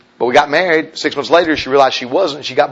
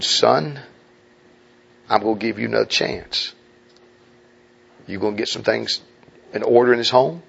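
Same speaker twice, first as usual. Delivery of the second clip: low, soft voice of the Lord; the Lord speaking gently; 4 semitones lower, 5 dB quieter than usual. Retold or imitated. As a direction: speak it gently, as God to a son. Voicing the Lord.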